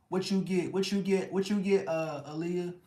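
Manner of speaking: very high, loud mock girly voice; nosy friend. To Aaliyah in chat, in a very high mock friend voice. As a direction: squeal the question like a prying friend.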